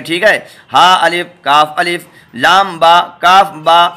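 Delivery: ordinary talk, nothing marked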